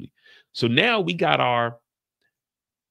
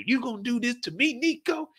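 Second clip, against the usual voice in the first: mock betrayed; 10 semitones higher, 4 dB quieter than usual. Betrayed character voiced.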